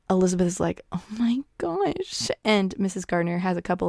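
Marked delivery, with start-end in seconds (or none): 0.9-2.3 slow, breathless whispered "Oh my gosh"; stunned whisper. Retold or imitated. Elizabeth realizing Darcy paid for the wedding, slow whisper. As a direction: hush it out like a secret shock. Elizabeth reacts to aunt's hint.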